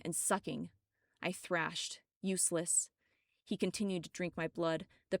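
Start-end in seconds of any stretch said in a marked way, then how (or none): none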